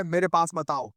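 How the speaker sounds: plain reading voice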